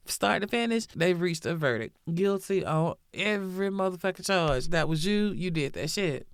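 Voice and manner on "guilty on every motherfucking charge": usual speaking voice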